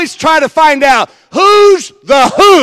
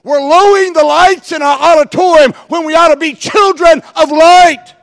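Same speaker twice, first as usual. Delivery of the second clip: higher and loud, punching light; preaching shout; 5 semitones higher, 4 dB louder than usual. Acted. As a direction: drive the line home like a sermon climax. Preaching climax.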